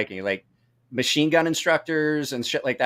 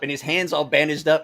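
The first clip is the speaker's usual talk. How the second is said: normal talking voice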